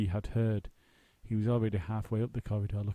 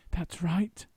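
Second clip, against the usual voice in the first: firmly; 8 semitones higher than usual. A narrator's reading. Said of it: "said Paddington firmly".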